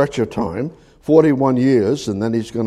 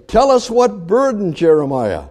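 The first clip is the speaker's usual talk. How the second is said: mocking demand; 7 semitones higher than usual. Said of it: "When they say".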